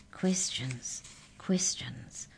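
whispered, hushed; soft whisper. A narrator's reading. The mouse answering Arthur in a hushed whisper. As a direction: breathe it out under your breath. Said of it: "the mouse replied softly".

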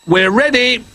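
high excited call from the bear; shout. A narrator's reading. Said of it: "he shouted".

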